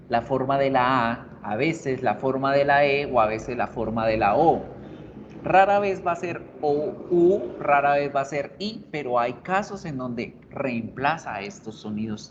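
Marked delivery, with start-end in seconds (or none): none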